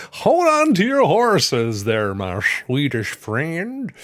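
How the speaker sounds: slow, high, loud hammed-up holler; big drawn-out comic call to a friend; playful mock accent. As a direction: stretch it out in a playful put-on voice. Mock-scolding a Swedish viewer.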